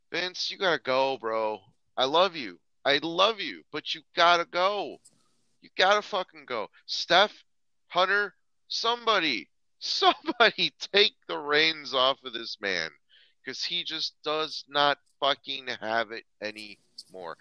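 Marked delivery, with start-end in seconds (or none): 0.1-11.9 pretend pleading. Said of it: "I'll be like".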